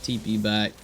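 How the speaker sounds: usual speaking voice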